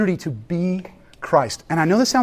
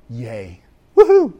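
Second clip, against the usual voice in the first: mock cheer; 4 semitones lower, slower than usual. Preacher mocking easy cheer to congregation, ironic whoop.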